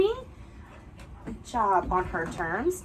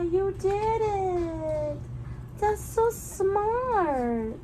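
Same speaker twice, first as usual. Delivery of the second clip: much higher, very slow fond praise; delighted dog praise; 11 semitones higher, much slower than usual. Real, not acted. Trainer praising her dog for succeeding, slow fond high. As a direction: stretch it out proudly for the dog.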